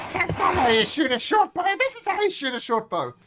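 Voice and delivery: loud, high goblin heckle; show-off goblin, high and loud; scolding goblin. Acted. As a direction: shout down a useless mate in a goblin voice. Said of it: "the goblin up".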